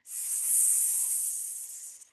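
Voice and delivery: long voiceless sss; snake hiss. Pretend. Teacher being the snake that is back, long soft hiss.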